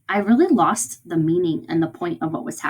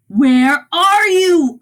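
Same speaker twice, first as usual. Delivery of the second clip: long, high, loud holler; furious yelling; 10 semitones higher, 8 dB louder, much slower than usual. Acted. Teacher acts out the angry father's booming call, yelling. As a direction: yell it out slowly, stretching each word. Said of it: "He's yelling".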